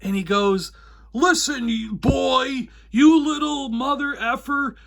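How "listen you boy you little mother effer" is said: high, loud enraged yell; blowing up. Retold or imitated. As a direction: lose it completely at the kid. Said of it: "the guy just blows up".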